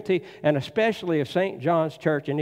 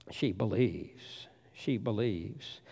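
softer, slow and sincere; soft conviction; 6 semitones lower, 10 dB quieter, slower than usual. Real, not acted. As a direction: let it sink in quietly. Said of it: "softly repeated".

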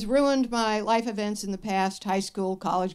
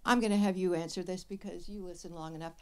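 usual speaking voice

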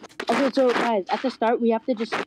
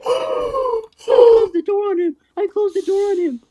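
whiny wail; 9 semitones higher, 4 dB louder than usual. Real, not acted. Wailing after a game death.

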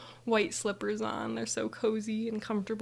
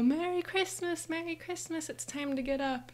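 high singsong holiday greeting, acted; excited wake-up call; 8 semitones higher than usual. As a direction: burst in to rouse the parents. Acting kids bursting in on parents.

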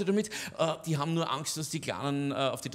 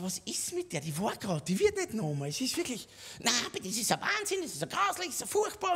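high mocking wail; mocking whine; 7 semitones higher, 3 dB quieter than usual. Comedian mimics the complaining granny in a high mocking wail. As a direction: parody her complaining, pitched high.

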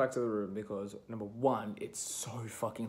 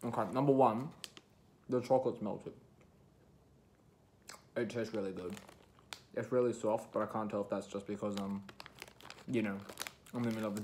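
chewing while talking; talking around food; mouth full. Real, not acted. Seen 2: Bites cookie just before, then closed working mouth, still holding cookie.

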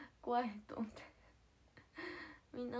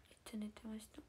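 ordinary talk

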